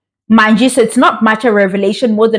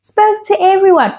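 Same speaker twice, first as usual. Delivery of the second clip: high-pitched feminine imitation; imitating her speech; 8 semitones higher than usual. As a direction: mimic her in a high, girlish register. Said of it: "this is exactly how she speaks".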